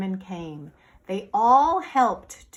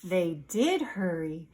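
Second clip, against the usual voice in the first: ordinary delivery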